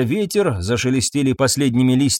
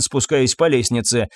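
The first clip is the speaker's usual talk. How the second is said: ordinary delivery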